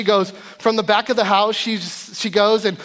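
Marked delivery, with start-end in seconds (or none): none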